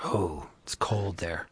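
soft slow whisper. Real, not acted. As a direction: breathe it out quietly. Aside about Minneapolis cold.

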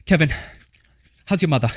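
loud Massachusetts aunt chewing gum; aunt asking how his mother is, in a loud Boston accent. Retold or imitated. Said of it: "That's the accent".

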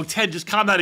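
high, loud retold plea; urging calm. Podcaster replaying their own line to Ted, exasperated. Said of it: "And then I go".

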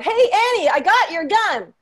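high, loud comic shout; big mock stage yell. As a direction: holler it out like calling across a stage. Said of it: "known to be shouting out".

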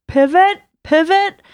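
high, loud "pivot"; Friends "pivot" shout. Retold or imitated. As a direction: yell "pivot" like the sitcom scene. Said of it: "Anyone who watches Friends".

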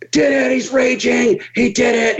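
excited shout. Retold or imitated. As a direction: shout it like the crew guy who saw the balloons pop. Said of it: "Go to the next one".

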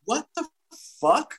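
high, hushed mock astonishment; baffled disbelief. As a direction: imitate a stunned crowd, pausing before the last word. Acts out the baffled crowd.

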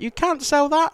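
high, loud mock shocked mum; mum's objection. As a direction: squeak it like an outraged mum. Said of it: "And Mum was like".

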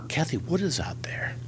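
hushed, secretive whisper; a quick whispered warning at the trailer door. Retold or imitated. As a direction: lean in and breathe the news. Scott sneaks news to Johnny.

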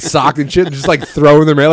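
normal talking voice

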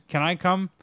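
ordinary delivery

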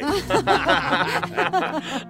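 awkward laugh. Acted. Laughing off affair question.

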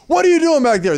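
high, loud teacher yell at the back row. Retold or imitated. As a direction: bark it like a strict teacher catching someone. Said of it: "because he would just yell out and be like".

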